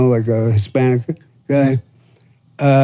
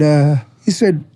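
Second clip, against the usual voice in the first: ordinary delivery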